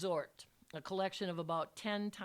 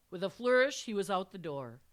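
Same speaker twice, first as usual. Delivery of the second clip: plain reading voice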